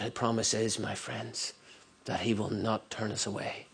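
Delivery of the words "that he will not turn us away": ordinary talk, nothing marked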